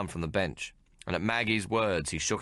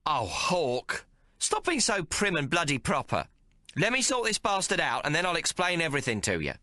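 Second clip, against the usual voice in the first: high, impatient cockney; exasperated Maggie; 11 semitones higher than usual. A narrator's reading. Maggie telling Hawk to stop being proper, impatient. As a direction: brush him off.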